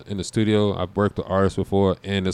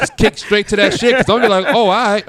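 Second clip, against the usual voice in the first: excited laughing; 10 semitones higher, 7 dB louder than usual. Real, not acted.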